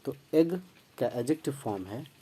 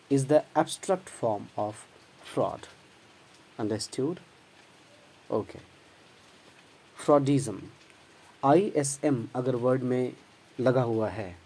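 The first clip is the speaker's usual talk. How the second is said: usual speaking voice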